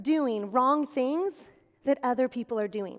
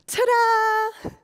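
loud, much higher magic-trick flourish; showy reveal; 8 semitones higher, 7 dB louder than usual. Acted. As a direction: present yourself like a magician. Reappears in new clothes.

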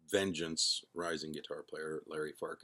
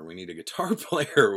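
laughing; 2 semitones higher, 10 dB louder than usual. Retold or imitated. Incredulous self-quote to would-be singer.

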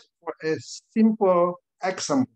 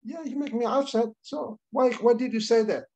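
put-on child voice; 6 semitones higher than usual. Child and father dialogue.

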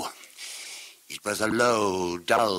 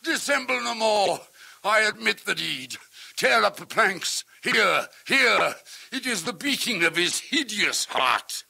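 frantic confession; 9 semitones higher than usual. A narrator's reading. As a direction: blurt out the guilt in a frenzy. Confession screamed after shriek.

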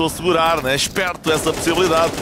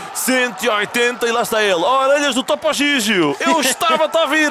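excited play-by-play; 7 semitones higher than usual. Real, not acted. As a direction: yell the big play. Excited live call of a play.

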